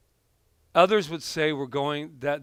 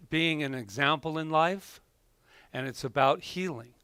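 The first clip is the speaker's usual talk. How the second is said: ordinary delivery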